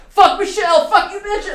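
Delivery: very high, very loud gleeful yell with a laugh; excessive reaction. Retold or imitated. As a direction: scream it out with mock triumph. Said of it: "an excessive reaction".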